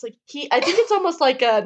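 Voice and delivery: ordinary talk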